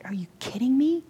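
whispered disbelief; hushed astonishment. Retold or imitated. Preacher reacting to the worker, whispered. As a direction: whisper it, stunned.

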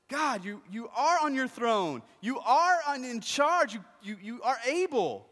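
high, drawn-out shouted prayer; crying out. Acted. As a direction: hammer out the praise at full voice. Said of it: "he's crying out".

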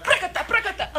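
playful burst of tongues, high and loud with a laugh. Real, not acted. Preacher caught up in the moment, laughing burst of tongues. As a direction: let a quick tongues phrase pop out. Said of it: "I got inspired by the little moment there".

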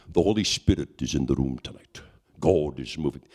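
low-pitched imitation of a preacher; Rodney Howard-Browne. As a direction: preach it in a deep, put-on voice. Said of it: "And Rodney is preaching".

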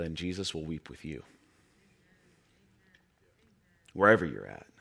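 low, soft, consoling sermon delivery; tender preaching. Real, not acted. Preacher assures hurting listeners that Jesus weeps with them, tenderly. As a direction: drop low and quiet to comfort the congregation.

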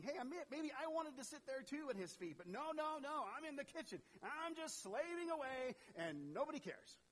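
high, whiny self-pitying rant; Martha's self-pity. Acted. Preacher voicing Martha's thoughts, high whiny grumble. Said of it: "she may have been thinking".

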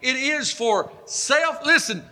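high, impassioned sermon delivery; full-force preaching. Real, not acted. Preacher urging congregation to fast for deliverance, impassioned.

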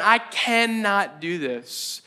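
slow, drawn-out admission of defeat; heavy, deliberate resistance, acted out; despairing refusal. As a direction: stretch it out like someone giving up. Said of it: "that's that moment of going".

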